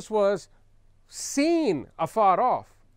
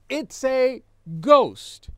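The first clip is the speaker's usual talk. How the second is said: mock fearful cry, high and loud; slow, drawn-out cry, raised in pitch and volume; 5 semitones higher, 7 dB louder, much slower than usual. Preacher reads the frightened disciples' cry, loud and drawn out. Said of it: "they cried out".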